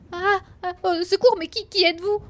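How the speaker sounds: cri aigu et tremblant; s'écrier, très haut, de terreur; tremblant de peur. A narrator's reading. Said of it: "s'écrie-t-il alors tout tremblant". (voix tremblante de peur)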